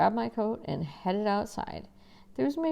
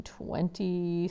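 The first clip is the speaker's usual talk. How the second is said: ordinary delivery, nothing marked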